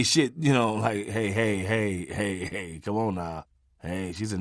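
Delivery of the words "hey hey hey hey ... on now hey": low, fading nervous "hey, hey"; backing off a threat, voice shrinking; soft jittery pleading, quieter and quieter. Retold or imitated. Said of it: "when you're nervous, when you say".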